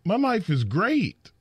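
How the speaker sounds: sing-song it like a smug man who is doing just fine; mock cheerful. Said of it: "He's like".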